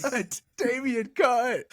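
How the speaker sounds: high, slow put-on yell; yelling cut like the deluded actor; mock yell. Imitating Tugg yelling cut.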